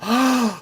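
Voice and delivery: sharp, high intake of breath; gasp. Real, not acted. Reacting to Johnny's kitten news, a high gasp. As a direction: gasp in real surprise.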